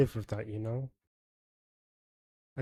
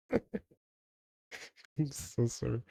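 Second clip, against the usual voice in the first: laughing apology; much slower than usual. Real, not acted. Apologizing after blasting a loud test sound.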